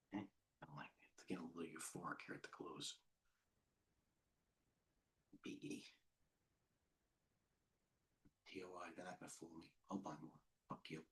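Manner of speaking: hushed, fast whisper to himself; a fast private whisper near the close. Real, not acted. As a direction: whisper it under your breath, quick.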